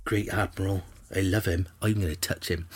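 low, very soft awestruck murmur; hushed adoration. Acted. Imagined Bristolian follower fawning over the Great Admiral, hushed adoration. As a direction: breathe it out like a smitten admirer.

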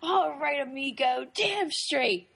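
high, hammed-up tough-guy talk; fake macho voice. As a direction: play butch, badly. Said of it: "and then he says".

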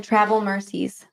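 normal talking voice

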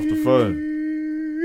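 slow, high, stretched delivery; drawn-out punchline. Real, not acted. Punchline of the story.

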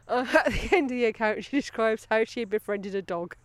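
ordinary delivery

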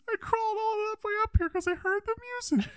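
very high, squeaky sob story; hammed-up high-pitched wail; mock anguish. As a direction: plead pitifully in a put-on falsetto, rushing along. Same anguished character acted out.

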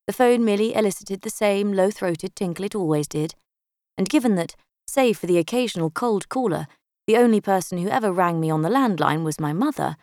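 ordinary delivery

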